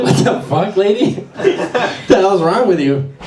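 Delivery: indignant, laughing through the words; half-laughing "what the hell"; laughing mock outrage. Comic snaps at rude subway lady while laughing.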